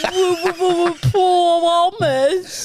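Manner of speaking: mocking stammer impression. Mocking the stammer impression back at her, whiny. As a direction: throw back her mock stutter on promise. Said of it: "As if I would ever say".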